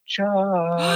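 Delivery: high sing-song call of a name; lightly sung name call, ghostly; sing-songy. Retold or imitated. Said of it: "in a very sing-songy voice".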